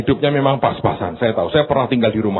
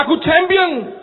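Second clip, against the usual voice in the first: proud declaration. Acted. Preacher voicing confident believer.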